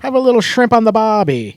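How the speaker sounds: loud, high put-on Australian accent; mock Australian accent. Host teasing co-host with a misplaced Australian accent. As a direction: call it out like a hammed-up Australian. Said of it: "That's Australia".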